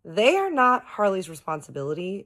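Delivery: high, loud, emphatic correction; retold indignant scolding. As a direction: lay down the point in acted indignation. Retold quarrel, scolding Bob.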